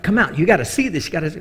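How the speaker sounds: high, eager call to wake people; excited calling. Retold or imitated. Preacher waking shipmates to see the lights, excited. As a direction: urge everyone up, thrilled.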